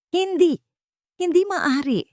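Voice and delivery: high, loud hysterical scream of denial. A narrator's reading. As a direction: shriek it as she's dragged away. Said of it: "Histerikong sigaw ni Vanna". (sumisigaw nang histerikal, mataas at malakas)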